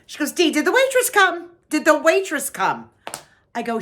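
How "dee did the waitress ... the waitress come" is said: high, insistent imitation of a neighbour; mock-urgent high question; imitating drunk friend. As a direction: pitch it up, pestering for a drink. Drunk friend asks after waitress.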